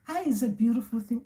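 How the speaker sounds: usual speaking voice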